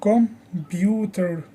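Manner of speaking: slow comPUter, weight on pu; drawn-out teacher's Computer, stressed on pu; stress on second syllable. Shown as a demonstration. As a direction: say Computer slowly, leaning on the middle syllable. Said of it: "The stress is on the second syllable".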